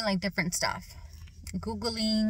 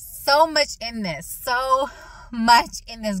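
slow and a bit louder, drawn out; slow emphatic; 4 semitones higher, 5 dB louder, slower than usual. Shown as a demonstration. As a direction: lean in and slow each word. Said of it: "I'm gonna try to talk slow".

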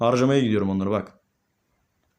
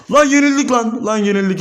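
loud, high cry of defeat; real high-pitched gamer outburst; outraged disbelief; 8 semitones higher, 6 dB louder than usual. Real, not acted. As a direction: burst out in dismay at a sudden loss. Reacts to losing at last second.

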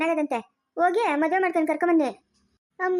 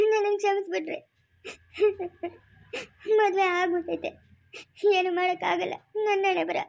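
crying, broken plea for forgiveness; tearful apology. Acted. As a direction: beg forgiveness through sobs. Sister tearfully asks forgiveness.

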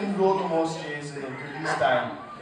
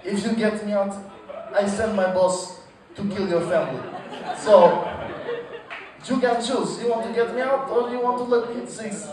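menacing mock Russian accent; Russian batsman threatening the bowler in a mock Russian accent; Russian gangster. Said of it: "He comes to the crease and bat and say".

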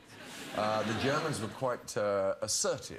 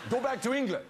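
raised, high-pitched mock heckle; mock angry jeer; 11 semitones higher, 4 dB louder than usual. Actor imitating Germans angry over autographs, mock angry jeer. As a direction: jeer it like an angry crowd, playfully.

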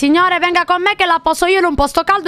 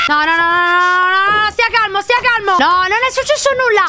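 high, rapid string of nos; panicked pleading; 6 semitones higher than usual. Real, not acted. Streamer begging a figure to stay calm, panicked pleading. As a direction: beg a threat to calm down.

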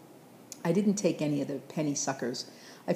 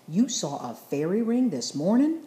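louder, higher disbelieving question; rather loud; 4 semitones higher, 5 dB louder than usual. Acted. Grandpa, stunned by the boy's news, asks loudly. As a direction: blurt it out, can't believe it. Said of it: "Grandpa said in a rather loud voice".

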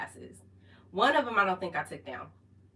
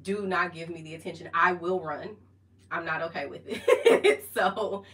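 ordinary delivery

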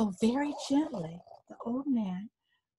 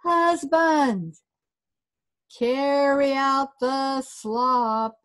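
high, loud, slow call across the house; wife calling her husband to carry the slop, a loud slow call; 4 semitones higher, 5 dB louder, much slower than usual. Acted. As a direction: call him out, drawn slow. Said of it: "she would call her husband".

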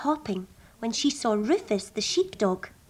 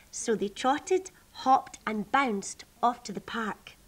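ordinary delivery

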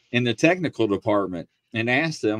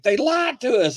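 high, indignant outrage; shocked Brits; 11 semitones higher than usual. Retold or imitated. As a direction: cry out betrayed. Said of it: "They're like".

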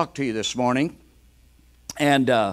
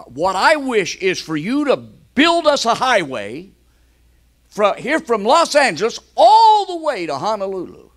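higher, louder eager husband; eager wish; 8 semitones higher, 8 dB louder than usual. Retold or imitated. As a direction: push the wish out bigger and bigger. Husband's big wish in joke.

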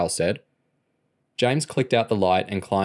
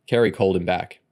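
usual speaking voice

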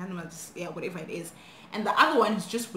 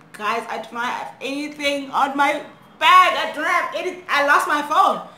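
loud, higher mock-tearful plea; imitated crying; 5 semitones higher, 8 dB louder than usual. Young girl showing intruders her empty bag, mock crying. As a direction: plead as if crying, louder and higher. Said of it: "I'm just crying".

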